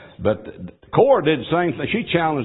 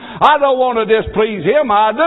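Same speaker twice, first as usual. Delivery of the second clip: loud, high pleading preacher's cry; impassioned revival sermon, rising at the end; fervent preaching; 9 semitones higher, 6 dB louder than usual. Acted. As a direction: plead with the congregation, building. Preaching at full force.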